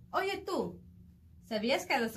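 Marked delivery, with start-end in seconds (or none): 0.1-0.8 calling out. Acted. Calling out to reader.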